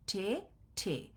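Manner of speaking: ঠে stretched out slowly, said twice; slow demo. Shown as a demonstration. Teacher repeating ঠে slowly for students. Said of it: "ঠ একার ঠে".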